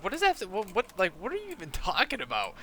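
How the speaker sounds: high, cracking, laughing disbelief; can't believe what he just heard; retelling his baffled reply to the buyer with a laugh; incredulous disbelief. Retold or imitated.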